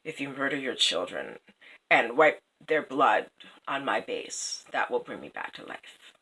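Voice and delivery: a hint of a grim stone oracle; low hushed statue. The statue quietly telling the king its terrible price. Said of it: "the statue said".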